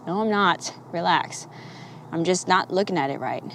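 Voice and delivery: quiet reassuring inner voice; calm inner reply. Retold or imitated. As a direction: talk yourself down gently. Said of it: "It's like".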